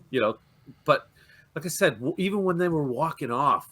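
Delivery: ordinary talk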